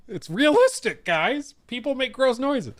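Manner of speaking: mock chat voice. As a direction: parrot the commenters in a silly high voice. Repeats chat line mockingly.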